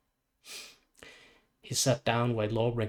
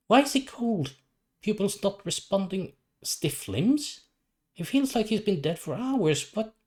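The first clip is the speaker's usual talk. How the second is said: put-on a doctor shocked to find the boy long dead; alarmed doctor; 8 semitones higher than usual. Alarmed doctor at crash scene.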